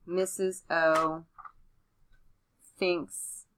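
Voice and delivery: slow, word-by-word dictation; dictating. Shown as a demonstration. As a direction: say each word as you write. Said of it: "pick what we said".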